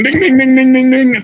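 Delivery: loud, high ding-ding siren noise; siren imitation. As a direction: belt it out like a fire truck bell. Imitating fire truck sirens arriving.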